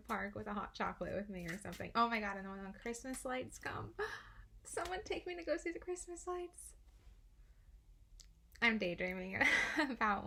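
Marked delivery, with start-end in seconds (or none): 4.0-6.7 excited. Real, not acted. Gasp then dreamy plea.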